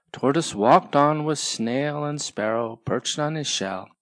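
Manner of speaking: usual speaking voice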